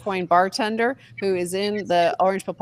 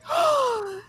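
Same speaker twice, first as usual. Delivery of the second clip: mock gasp. Mock shocked headline reaction.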